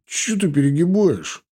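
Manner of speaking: put-out, rising complaint; offended. A narrator's reading. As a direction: object as if mildly wounded. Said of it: "обиделся Лёня".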